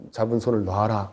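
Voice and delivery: low, soft divine command; quietly solemn order from above; voice of God. Retold or imitated. God repeating the order to the pastor, low solemn voice. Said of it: "음성이 딱 들려온 거예요".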